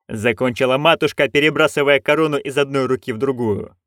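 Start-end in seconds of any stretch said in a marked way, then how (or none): none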